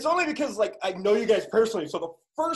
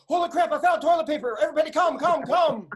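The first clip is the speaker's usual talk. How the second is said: much higher and louder, over-the-top thrill; mock excited Facebook post; 9 semitones higher, 5 dB louder than usual. People on Facebook announcing toilet paper, hammed-up excitement. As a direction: squeal it like you just struck gold. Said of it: "Now people are checking in going".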